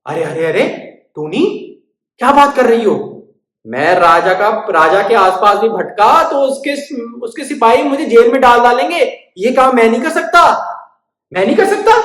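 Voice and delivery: high, loud panicky mouse; frightened refusal. Acted.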